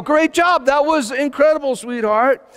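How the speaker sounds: high, loud cheering mother; yelled praise. Retold or imitated. Mother praising her little girl on the slide, high excited yell. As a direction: yell it out like a proud parent. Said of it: "and then just yell".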